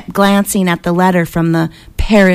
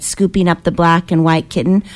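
ordinary delivery, nothing marked

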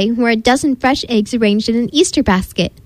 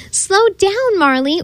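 ordering; 8 semitones higher, slower than usual. A narrator's reading. Cassie ordering her runaway dog as she chases, high call. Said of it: "ordered Cassie".

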